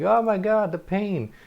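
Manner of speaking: high, loud hammed-up cry of pain; overacted scream of agony from a cancer film; mock movie scream. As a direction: ham it up like a melodramatic movie patient. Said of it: "you see the person screaming".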